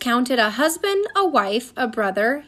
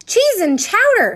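high, loud exclaimed oath; dismayed exclamation; 9 semitones higher, 7 dB louder than usual. A narrator's reading. Missus Pepper hearing about taxes and soldiers, exclaiming loudly. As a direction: cry out in dismay.

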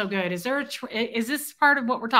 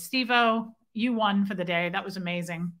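ordinary delivery

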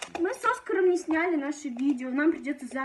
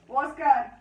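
louder sharp call to a pet; calling the dog; 5 dB louder than usual. Real, not acted. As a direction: snap the dog's name out.

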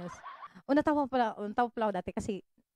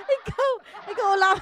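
higher, louder laughing "ikaw ulam"; playful tease; 7 semitones higher, 5 dB louder than usual. Pretend. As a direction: shout the flirty joke as a gag. (malakas na pabirong landi)

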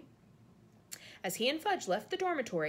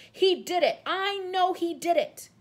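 loud, higher and slow furious insistence; shrieking; 10 semitones higher, 9 dB louder, slower than usual. A narrator's reading. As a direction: hammer each word in rage. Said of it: "shrieked Snape".

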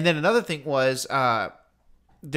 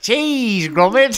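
higher, louder cheery Wallace voice; Wallace impression; 9 semitones higher, 9 dB louder, much slower than usual. Host quoting the cartoon in a high cheery Wallace voice. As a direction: say it like the cartoon inventor.

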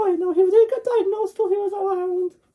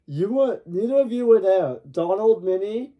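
deep cartoon voice. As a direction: talk deep like a goofy dog. Puppet voice for Goofy.

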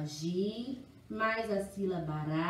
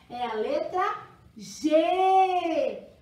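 loud, higher, drawn-out reveal; triunfo animado; 6 semitones higher, 7 dB louder, slower than usual. Shown as a demonstration. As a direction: announce it big to small children. Teacher triumphantly reveals the letter. (revelação animada, alta e esticada)

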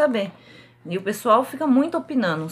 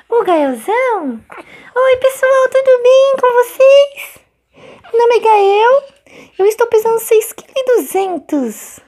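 put-on baby voice; 12 semitones higher, 9 dB louder than usual. Mother voicing her baby Gael for YouTube viewers, high cutesy baby voice. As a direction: pretend to be the chubby baby talking.

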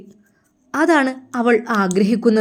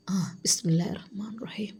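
soft breathy murmur, barely voiced; a husband murmuring bismillah before he drinks; whispered prayer; 2 semitones lower, 12 dB quieter than usual. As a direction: whisper the blessing under your breath.

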